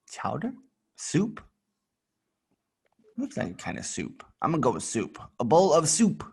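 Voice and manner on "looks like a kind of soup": muttered, much softer; quiet aside. Real, not acted. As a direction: murmur it to yourself. Aside while guessing.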